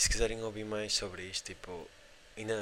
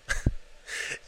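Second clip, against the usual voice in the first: burst of laughter; 6 dB louder than usual. Real, not acted. Laughing at the scene.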